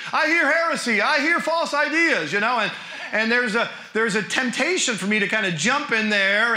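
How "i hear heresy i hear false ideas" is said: high-pitched mock alarm; too excited. Truth-loving professor spotting heresy, comically over-excited. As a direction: play an over-animated heresy detector, pitched up. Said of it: "I get just a little too excited".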